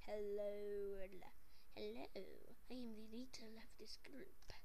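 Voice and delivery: low, hushed leader voice; changed character voice. Acted. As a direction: introduce yourself in a quiet, low, half-whispered voice. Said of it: "why'd your voice change?".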